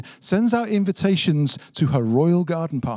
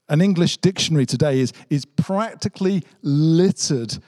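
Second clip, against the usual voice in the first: usual speaking voice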